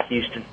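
"Houston" with the opening reduced, Texan style; Texan pronunciation. Shown as a demonstration. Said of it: "how people from Texas call it".

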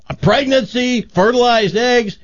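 high, hammed-up marvelling; acted excitement. Woman marvels at both pieces of news, mock excitement.

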